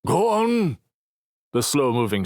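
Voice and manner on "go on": put-on high, strained old man's shout; croaky elder waving them off; old man shooing. Old man shooing the crowd, high strained shout.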